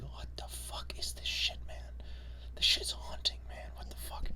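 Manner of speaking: unvoiced, stunned whisper; hushed disbelief at a haunting ending; awed whisper. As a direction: whisper it like you're spooked by the game. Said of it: "I was like".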